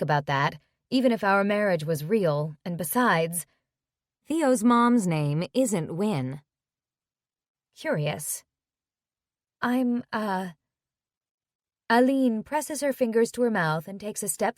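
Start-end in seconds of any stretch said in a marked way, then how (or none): none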